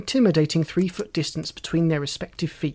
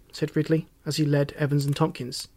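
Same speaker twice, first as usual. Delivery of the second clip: ordinary delivery, nothing marked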